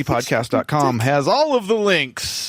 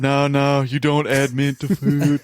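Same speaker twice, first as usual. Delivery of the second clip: Trump impression. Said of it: "You just sounded like Trump".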